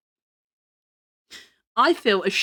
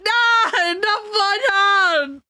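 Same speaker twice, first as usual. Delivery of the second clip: whiny fake sob; mock crying; 8 semitones higher, much slower than usual. Real, not acted. Dreading friends leaving for game.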